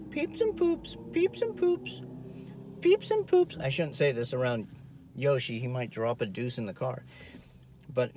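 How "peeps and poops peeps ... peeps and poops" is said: much higher, sing-song chant. Shown as a demonstration. Trainer showing the dog its poop, high sing-song.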